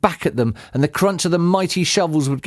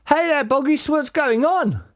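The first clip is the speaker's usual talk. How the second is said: high-pitched nosy call; onlooker calling down; 14 semitones higher than usual. Acted. Villagers call to Boggis from the crater edge, hailing. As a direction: hail someone far below in a high voice.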